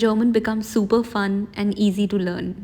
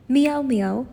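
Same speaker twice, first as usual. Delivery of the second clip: light mock meow, slightly raised; cat meow; 4 semitones higher than usual. Teacher voicing the cat in the dialogue, light mock meow. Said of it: "how a cat would do meow meow".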